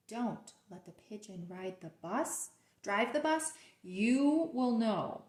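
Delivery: usual speaking voice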